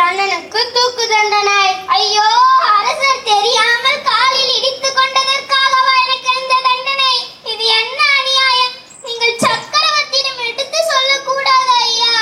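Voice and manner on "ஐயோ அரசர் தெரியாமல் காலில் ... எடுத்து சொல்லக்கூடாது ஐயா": high, whiny voice of a frightened gardener; a poor gardener, higher and wailing in disbelief; a gardener pleading his innocence, high and whiny; panicky gardener. Acted. Gardener protests his death sentence.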